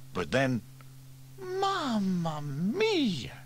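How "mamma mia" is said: high, long-drawn sigh of dismay, falling away; weary, stretched-out exclamation; Bella sighing. A narrator's reading. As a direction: let it sink slowly from high up. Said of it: "she sighed".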